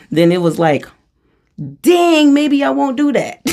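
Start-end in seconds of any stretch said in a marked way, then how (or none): 1.8-3.4 high, loud startled "dang"; jolted into sudden doubt; surprised realization. Retold or imitated. Retelling a lesson learned.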